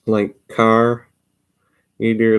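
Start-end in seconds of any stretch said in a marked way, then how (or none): 0.5-1.1 "car" with a long, rolled-out R; "car" stretched on a North Central R. Shown as a demonstration. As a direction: draw out the R in "car". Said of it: "So you say car, it rolls off".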